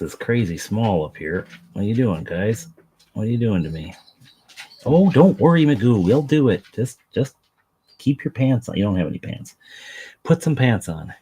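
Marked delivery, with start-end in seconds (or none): 4.8-10.9 talking to the dog. Real, not acted. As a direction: coax the dog like a pet owner. Talking to his dog Magoo.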